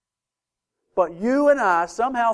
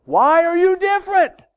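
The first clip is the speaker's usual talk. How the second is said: challenging; 11 semitones higher, 5 dB louder than usual. Real, not acted. Preacher challenges congregation.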